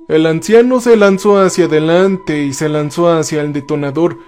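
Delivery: plain reading voice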